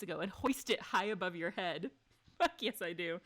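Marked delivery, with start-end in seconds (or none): 2.4-2.8 laughing, louder and higher fuck yes; triumphant cheer. Real, not acted. Streamer cheers her character's car throw, laughing and loud. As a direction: celebrate the win.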